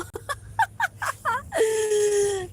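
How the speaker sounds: high squealing laugh that breaks into a cry; helpless laugh at a teasing comment; real crying laugh. Real, not acted. Laughing off a viewer's teasing on stream, squealing.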